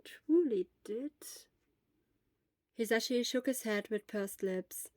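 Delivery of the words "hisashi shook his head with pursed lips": ordinary delivery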